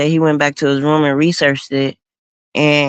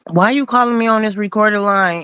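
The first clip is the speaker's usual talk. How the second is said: retold angry rebuke, pitched higher; lightly acted angry scolding; 5 semitones higher than usual. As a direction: voice the lawyer snapping at him on the phone. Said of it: "Creel just lights into him".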